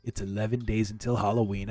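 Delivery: ordinary talk, nothing marked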